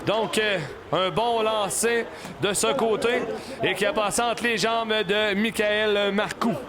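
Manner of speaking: excited sports commentary. Real, not acted. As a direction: shout the goal. Goal call in live commentary.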